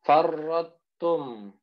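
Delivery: slow drawn-out recitation of Farrotum; tajwid recitation. Shown as a demonstration. Teacher demonstrating to students, slow recitation. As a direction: chant Farrotum slowly and clearly. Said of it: "Ahal tu".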